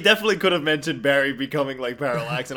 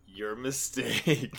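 slow menacing taunt; 3 semitones lower, 8 dB quieter, much slower than usual. Acted. Villain Barry taunts Wally.

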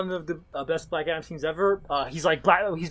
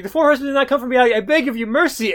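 pleading leader; 6 semitones higher, 4 dB louder than usual. Retold or imitated. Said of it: "the leader of that country is like".

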